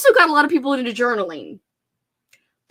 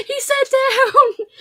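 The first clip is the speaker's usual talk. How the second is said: high, drawn-out laughing wail; loud giggly mock dismay; amused squeal; 5 dB louder, much slower than usual. Real, not acted. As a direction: squeal it through laughter, stretched out. Laughing as dog sits down.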